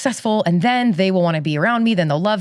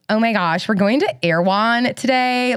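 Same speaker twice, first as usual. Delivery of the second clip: put-on drawn-out LA Valley Girl accent; 4 semitones higher than usual. Shown as a demonstration. LA transplant doing an impression on request, Valley Girl accent. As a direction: do a Valley Girl with stretched words. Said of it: "Do me a Valley Girl".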